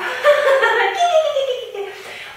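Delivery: high giggling laugh; rabbit laugh. Acted. Rabbit laughing at the disguised lion, high giggle. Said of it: "that made Rabbit laugh".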